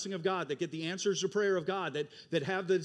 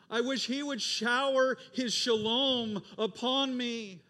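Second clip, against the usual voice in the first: higher, louder, slow yearning plea; longing wish; 8 semitones higher, 6 dB louder, slower than usual. Acted. An onlooker to God, slowly longing for answered prayer. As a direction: ache for it, drawing each wish out.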